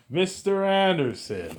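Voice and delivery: a mock movie villain greeting his enemy; imitating Agent Smith. The Matrix villain greeting Neo, in a drawn-out mock menace.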